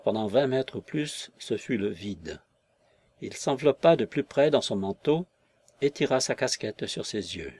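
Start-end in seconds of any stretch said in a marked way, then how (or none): none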